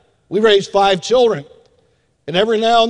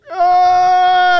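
high imitated infant cry, louder; hammed-up baby scream; mock baby wail; 10 semitones higher, 4 dB louder than usual. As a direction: wail like a siren going off. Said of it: "And they start crying".

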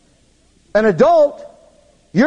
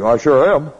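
put-on low girl reply; 7 semitones lower than usual. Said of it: "And she goes".

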